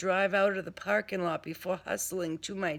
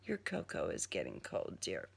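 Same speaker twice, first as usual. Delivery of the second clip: low soft purr; 12 semitones lower, 10 dB quieter than usual. Acted. Mayhem urges her to drink.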